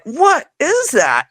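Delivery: high, slow, startled question; jolted by a blow from behind. Retold or imitated. Knocked on the head.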